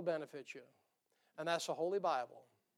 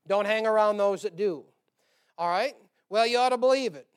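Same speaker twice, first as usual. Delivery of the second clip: loud preaching push; 9 dB louder than usual. Acted. Preaching at full force.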